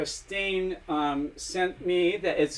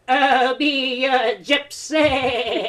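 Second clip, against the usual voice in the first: slow, higher mock [name] croon; [name] impression; 6 semitones higher, 4 dB louder, much slower than usual. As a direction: ham up a bad [name]. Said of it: "my [name] impression".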